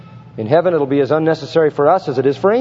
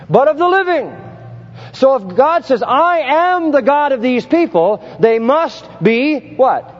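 full-force preaching; 8 semitones higher than usual. Acted. Preacher rises to his climax before the congregation, full force. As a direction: drive the point home to the congregation.

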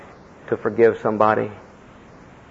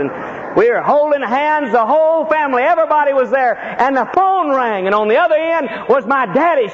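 preaching at full force; 12 semitones higher than usual. Acted. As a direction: build it like a pulpit climax.

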